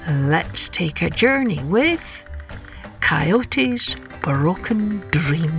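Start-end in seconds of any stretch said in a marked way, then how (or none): none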